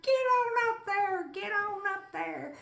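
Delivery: high, loud push; excited urging. Retold or imitated. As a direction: shout it up the rock. Urging lizard up rock.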